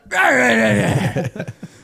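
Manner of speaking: high, loud put-on laugh; imitated goofy laugh. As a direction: cackle like the guy from the movie. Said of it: "He'd be like".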